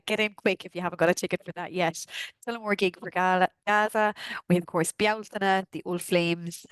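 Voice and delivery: ordinary talk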